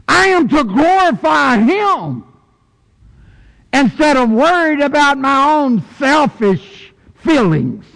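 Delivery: high, loud, ringing preacher voice; full-force preaching. Acted. Preacher proclaims God's love to the congregation, at full force. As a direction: preach it out to the back pew.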